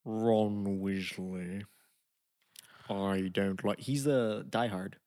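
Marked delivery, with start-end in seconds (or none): 0.0-3.8 hint of a stern professor; Snape impression. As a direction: draw out each word like a bored stern teacher. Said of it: "How does Snape talk?".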